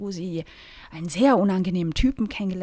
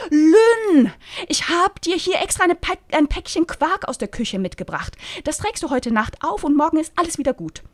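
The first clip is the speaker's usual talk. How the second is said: high, loud chirpy busybody; gushing Rosi; 7 semitones higher, 3 dB louder than usual. Acted. Rosi fussing over Lynn's sunburn, high and chirpy.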